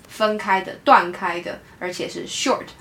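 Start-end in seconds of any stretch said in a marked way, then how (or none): none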